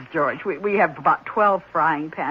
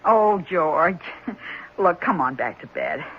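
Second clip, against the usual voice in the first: ordinary talk, nothing marked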